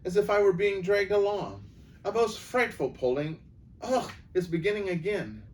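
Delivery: gasping the words out while being pulled; breathless and alarmed. Acted. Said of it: "in a breathless voice".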